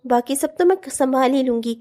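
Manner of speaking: ordinary delivery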